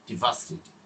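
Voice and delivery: falling devastating. Shown as a demonstration. Teacher showing how bad news sounds, devastating low and falling. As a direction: let devastating sink downward in a low voice. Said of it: "নিচের দিকে ফল করে".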